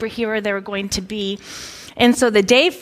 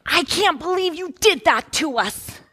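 high-pitched mock outrage of a child; put-on indignant child voice; mad kid; 8 semitones higher than usual. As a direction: imitate an upset kid accusing mom. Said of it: "the other one is just mad".

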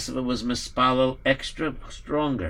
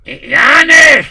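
calling out loudly; 10 semitones higher, 13 dB louder than usual. Acted. Calling out to servant.

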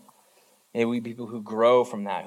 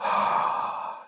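shocked audience gasp; a sharp breathless gasp of shock. Retold or imitated. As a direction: crowd gasping in alarm. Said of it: "And everybody listening goes".

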